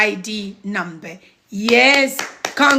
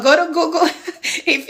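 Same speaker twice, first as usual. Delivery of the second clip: laughing; 5 semitones higher, faster than usual. Real, not acted. Joking about Doctor Google.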